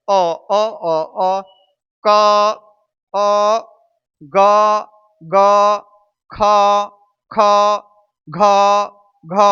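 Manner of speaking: क, ग, ख, घ each held to expose the अ; level, separated syllables letting अ ring in क, ग, ख, घ; hidden vowel demo. Shown as a demonstration. Teacher showing the vowel inside every letter, held syllables. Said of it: "सब के अंदर".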